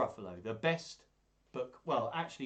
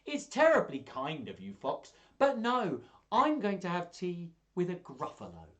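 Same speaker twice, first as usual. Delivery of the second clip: higher, brisk, polite little mouse; 7 semitones higher, 7 dB louder than usual. Acted. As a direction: turn the fox down brightly. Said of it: "do all the voices".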